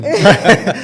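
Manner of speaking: short, loud amused laugh. Real, not acted. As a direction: laugh at the mix-up. Laughs at own answer.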